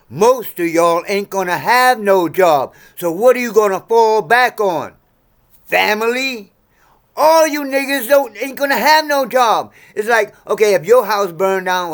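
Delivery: loud, high warning yelled at the viewers; ranting at his audience that they will have no jobs, shouting. Real, not acted. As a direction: build to a full yell. Escalating rant at viewers.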